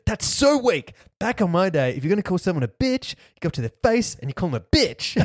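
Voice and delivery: put-on high, loud old-school tough guy sneering; Johnny scoffing. Cohost retells the sensei's scorn, high loud sneer. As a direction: scoff like an eighties bully hardliner. Johnny berates weak online bullies.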